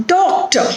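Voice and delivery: "Doctor" with a high first syllable; stress on first syllable. Shown as a demonstration. Teacher showing word stress to learners, first syllable of "Doctor" stressed. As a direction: hit the start of "Doctor", let the end fall. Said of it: "that is stressed is do".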